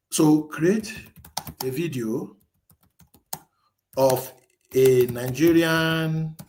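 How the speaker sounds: slow, deliberate dictating voice; slow dictation. Acted. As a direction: spell the prompt out word by word. Dictating a prompt to the AI.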